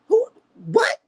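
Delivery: very high, baffled questions; high-pitched, stunned exasperation; incredulous outburst. Real, not acted. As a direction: squeak it out in disbelief. Acts stunned at her tough talk.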